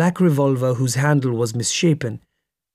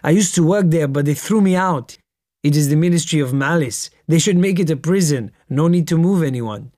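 usual speaking voice

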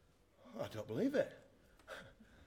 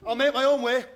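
defiant outburst; 6 semitones higher, 7 dB louder than usual. Acted. Storming out on his family.

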